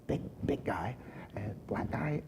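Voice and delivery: whispered, discreet aside; hushed aside. Real, not acted. Telling friends about the big man, whispered aside. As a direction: drop to a whisper for the touchy detail.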